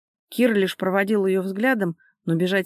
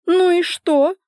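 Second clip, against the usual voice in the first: high, loud indignant retort; flaring up; 11 semitones higher, 7 dB louder, slower than usual. A narrator's reading. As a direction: fire back sharply, pitched way up. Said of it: "взвилась Лика". (взвившись, громко и высоко)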